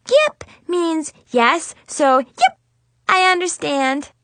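high squeaky yeps; nervous little girl. Acted. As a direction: blurt the yeps anxiously. Nervous kid repeats yep.

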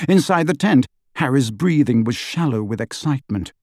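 ordinary delivery

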